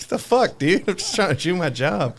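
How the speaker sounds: put-on a cranky old man snapping back at a young guy; grumpy old man. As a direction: whine like a cranky old man who's been bothered. Imitating an angry old man.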